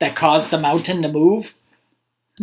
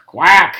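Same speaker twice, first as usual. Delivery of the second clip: duck quack; 5 dB louder than usual. A narrator's reading. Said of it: "The duck answered".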